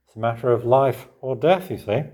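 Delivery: usual speaking voice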